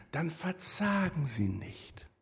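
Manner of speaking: slow, soft reassurance from a seminar leader; lightly voiced soothing coach. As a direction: gently counsel them, slowly and softly. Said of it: "sagt der Seminarleiter".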